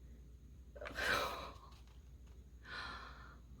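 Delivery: long, soft sigh of relief. Real, not acted. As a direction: let all the worry out in a breath. Relief after the positive test.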